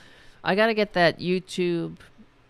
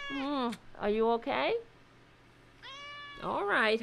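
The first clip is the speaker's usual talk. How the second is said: high, soft cooing pet talk; 5 semitones higher, 7 dB quieter than usual. Real, not acted. Talking to her cranky pet, high soft cooing baby talk. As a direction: coo softly to a cranky pet.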